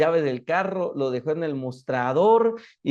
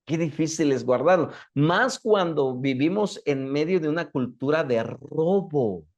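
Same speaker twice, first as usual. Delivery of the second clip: usual speaking voice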